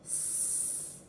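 just the hissed sound "s", no vowel; whispered S sound. Shown as a demonstration. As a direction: breathe out a lone voiceless "s". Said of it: "The beginning sound was".

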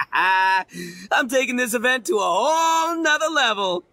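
laugh, then shout the boast with glee. Acted. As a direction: crow it out, thrilled with the plan. Itto boasts about his scare plan.

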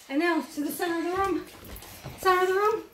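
ordinary delivery

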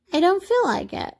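high-pitched little rabbit voice; high storybook toy voice; reluctant toy rabbit. A narrator's reading. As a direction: decline in a small, high voice. Toy rabbit declines to play.

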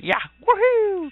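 very high woohoo like Mario; Mario's cheer. Retold or imitated. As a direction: mimic Mario's jump cheer. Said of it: "he has Mario sound effects".

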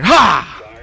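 short, loud, high burst; sudden yelp at a scare; startled shout. Real, not acted. Player turning the corner onto a scare, startled shout.

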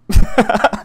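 short breathy laugh at his own joke; giggling at the elephant joke. Real, not acted. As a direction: burst out laughing at yourself.

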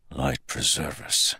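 soft, inward prayer; hushed thought to himself; inner thought. A narrator's reading. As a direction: murmur it to yourself. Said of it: "he thought".

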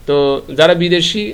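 ordinary talk

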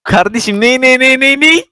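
surprised. Real, not acted. Hearing echo suddenly.

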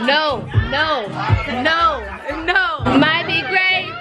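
ordinary talk

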